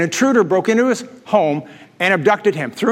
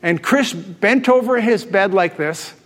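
ordinary talk

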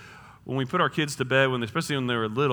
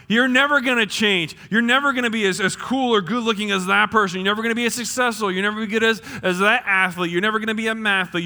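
loud, high, rapid-fire taunts; devil shouting lies; 6 semitones higher, 5 dB louder, faster than usual. Acted. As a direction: hammer out accusations without pausing. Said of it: "the devil will shout".

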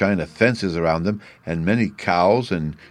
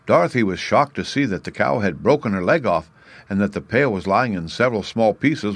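plain reading voice

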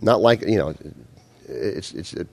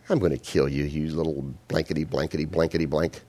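low, much softer sneering threat; contemptuous threat; 5 semitones lower, 8 dB quieter than usual. Retold or imitated. Goliath sneering at the shepherd boy, soft low threat. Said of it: "this contempt and this arrogance".

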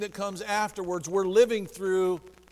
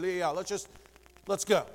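ordinary talk, nothing marked

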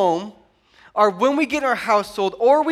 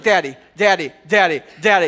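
put-on insistent kid calling Daddy, getting louder; nagging child; 3 semitones lower, 4 dB louder than usual. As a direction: pester dad until he answers.

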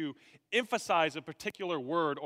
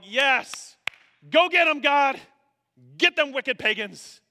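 loud, high egging-on shout; hearty cheering; 9 semitones higher, 11 dB louder than usual. Acted. Original readers urging God to get the pagans, hearty cheering shout. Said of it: "agreeing heartily with Paul's condemnation".

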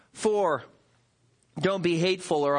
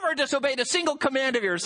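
quick, pitched-up mocking brag; self-righteous boast; 7 semitones higher, faster than usual. Preacher mimics older son to his father, smug and rushed. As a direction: rattle off the claim smugly. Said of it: "When he speaks to his father".

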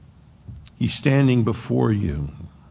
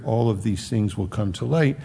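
normal talking voice

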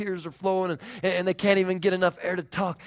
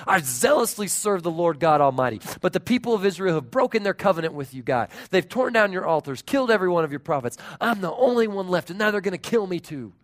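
loud, aggrieved complaint; self-pitying protest; 3 semitones higher, 8 dB louder than usual. Acted. Elijah complaining to God, in loud self-pity. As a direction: pour out the grievance loudly, hurrying. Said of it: "caught up in all that self-pity".

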